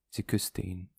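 ordinary delivery

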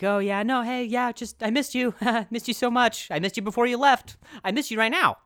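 high, over-eager affection; flustered gushing. Retold or imitated. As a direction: gush nervously to cover your panic. Acts flustered chat with girlfriend.

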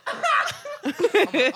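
high laughing squeal; cracking up retelling the class. Real, not acted. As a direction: break up laughing. Laughing hard mid-story.

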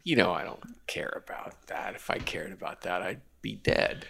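soft unvoiced whisper. Real, not acted. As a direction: breathe it out under the chatter. Deadpan aside to cohosts.

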